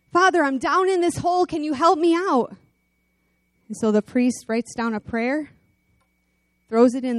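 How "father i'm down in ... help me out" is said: higher mock call; calling up from a hole. Man in a hole calling up to the priest. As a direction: shout up out of the pit. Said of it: "the guy shouts up".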